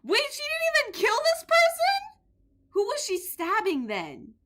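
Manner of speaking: high startled question; shocked. Real, not acted. Gamer startled the victim survived, very high. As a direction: blurt it out in disbelief.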